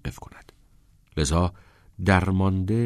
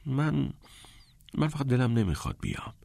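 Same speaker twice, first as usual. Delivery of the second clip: stammering; 6 semitones higher, 5 dB quieter than usual. A narrator's reading. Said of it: "منمن کنان گفت".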